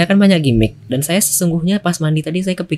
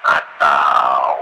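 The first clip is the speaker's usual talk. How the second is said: deep filter shout; 10 semitones lower, 3 dB louder than usual. Shown as a demonstration. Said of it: "ada yang ini".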